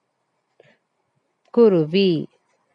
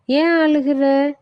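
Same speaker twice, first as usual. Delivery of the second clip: the coconut asks kindly why he cries; tender coconut's voice; 6 semitones higher than usual. Acted. Tender coconut asks the crying boy why, high cartoon voice. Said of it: "இளநீர் கேக்குது".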